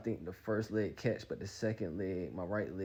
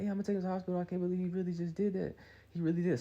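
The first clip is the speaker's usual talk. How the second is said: high-pitched mock disbelief; upset girlfriend; 11 semitones higher, 3 dB louder, faster than usual. Girlfriend answering her mama, high mock disbelief. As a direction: imitate her complaining, pitched way up. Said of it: "She's like".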